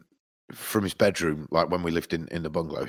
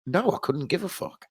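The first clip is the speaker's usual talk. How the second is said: inner grumble; 11 semitones higher than usual. Retold or imitated. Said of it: "of course I don't say that".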